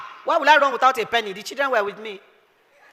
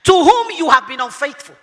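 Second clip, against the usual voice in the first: normal talking voice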